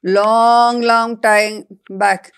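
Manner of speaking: Long stretched very slow and loud; drawn-out long. Acted. Brinjal tells the child an old tale, long drawn out loud. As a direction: lean hard on long, long.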